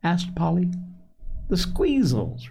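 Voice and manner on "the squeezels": high, drawn-out, mildly irritated repeat; irritable. A narrator's reading. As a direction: repeat THE SQUEEZELS slowly, pitched up, a touch testy. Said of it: "repeated Joel irritably".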